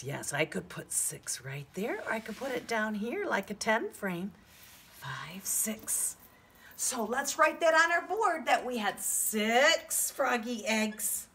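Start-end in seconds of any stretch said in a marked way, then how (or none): 5.0-6.2 whispered counting; whispering. Shown as a demonstration. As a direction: breathe the numbers softly.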